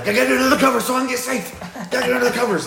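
high, rapid-fire squeal; comic scramble for safety in a squeaky voice; mock frantic panic. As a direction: gabble it fast and very high. Acts out frantic dash to bed.

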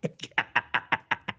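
silent wheezing laugh. Real, not acted. Breathless laugh.

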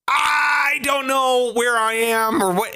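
high, slow, whiny cry of confusion; hammed-up overwhelmed driver at a chaotic French roundabout; mock panicked tourist. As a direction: wail like a lost tourist in traffic.

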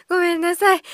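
usual speaking voice

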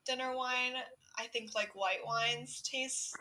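slow, drawn-out delivery; slow talking. Real, not acted. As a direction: stretch each word out slowly. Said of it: "he is making fun of my talking speed".

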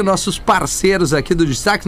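usual speaking voice

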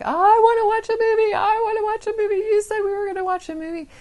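put-on high, loud wailing child; daughter's tantrum. Young daughter wailing at mom for a movie, high tantrum shriek. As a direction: shriek it like a furious little girl. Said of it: "her daughter just went ballistic".

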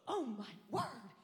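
slow, loud mock horror; shocked bystander. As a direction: draw it out as an aghast onlooker. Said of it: "she was going".

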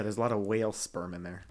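hushed conspiratorial whisper. Acted. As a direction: whisper it like a secret. Whispering back.

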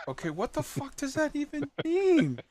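high, disbelieving squeak; baffled dad. Retold or imitated. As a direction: squeak it out in total confusion. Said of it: "And you're like".

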